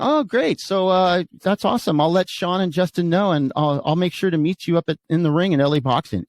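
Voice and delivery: high, falsely agreeable reply; sarcastic mock delight. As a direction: accept the threat with fake enthusiasm. Retold sarcastic reply to threat.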